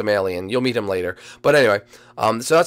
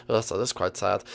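ordinary delivery

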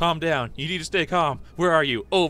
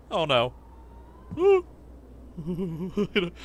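ordinary talk, nothing marked

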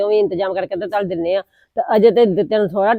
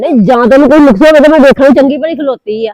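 louder, higher angry retort; heated retort; 4 semitones higher, 9 dB louder than usual. Acted. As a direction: fire back at her in the quarrel. Heated quarrel over the cart.

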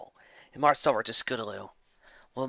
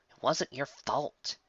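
tender; 3 semitones higher, 5 dB quieter, slower than usual. Acted. Applejack consoles Rainbow.

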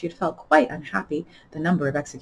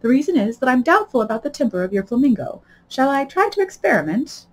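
high-pitched Duchess voice; doubtful Duchess; 7 semitones higher, 3 dB louder than usual. Acted. Duchess doubtful about Alice's flamingo, high and coaxing. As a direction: propose it in a high, lilting Duchess voice.

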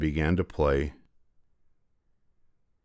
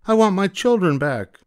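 very high, louder call; mother demanding at the witch's hut, very high and loud; 12 semitones higher, 5 dB louder than usual. Acted. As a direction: demand at the door. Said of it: "the mother called".

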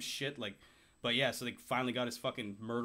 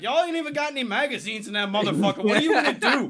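high, loud laughing taunt; mocking crowd; 8 semitones higher, 10 dB louder than usual. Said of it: "be like".

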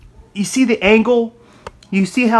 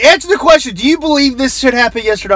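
higher, insistent host pressing for an answer; pushy radio host; 6 semitones higher than usual. Retold or imitated. Radio host pressing a liberal guest, demanding.

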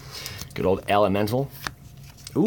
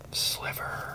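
whisper; 9 dB quieter than usual. Real, not acted. Whispered card reveal.

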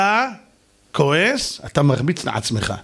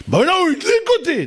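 very high, indignant mock yell; worked-up outburst; 13 semitones higher than usual. Provoked man blaming the other, yelling. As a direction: shoot up high as if just set off. Mocking someone provoked into anger.

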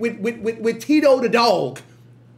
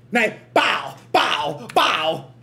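ordinary delivery

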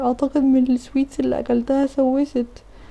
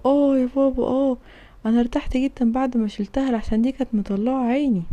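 ordinary delivery

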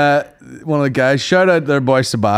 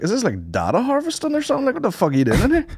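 high, fast disbelieving squeal; pitched way up in mock alarm at the strange room setup; baffled outburst; 12 semitones higher than usual. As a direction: squeak it out in shock. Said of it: "I was like".

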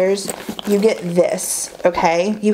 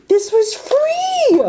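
very high slow squeal on free; squealed disbelief; slower than usual. Real, not acted. Telling viewers it was free, very high slow squeal. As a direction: stretch it into a thrilled squeak. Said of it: "and this was all free".

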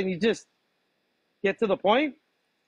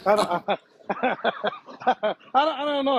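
flustered, laughing through the words; 7 semitones higher than usual. Real, not acted. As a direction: giggle helplessly while answering. Confused by the odd question.

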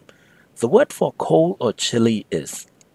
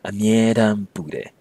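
slow teaching read; slower than usual. Shown as a demonstration. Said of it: "you get".

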